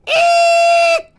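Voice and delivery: extremely high, loud "eek"; hammed-up piercing squeal of terror; frightened squeal. Last robber confronted by the little girl, squealing.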